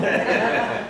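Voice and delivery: a soft, high laugh at his own cheek; high breathy laugh. Real, not acted. Speaker to audience, amused at his flippancy, light high laugh.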